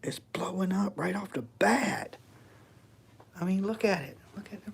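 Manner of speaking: hushed unvoiced whisper. Real, not acted. Tense ghost hunt, reader spiking.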